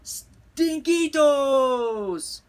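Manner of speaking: long-drawn high loud call. A narrator's reading. Skipito calls his old amigos, drawn-out shout. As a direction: holler it, stretching the word slowly. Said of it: "called out Skippy John Jones".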